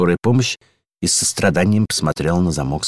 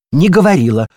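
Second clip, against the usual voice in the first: louder, higher flat refusal; stubborn denial; 9 semitones higher, 7 dB louder than usual. A narrator's reading. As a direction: dig in and deny it. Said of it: "заупрямилась дверная ручка".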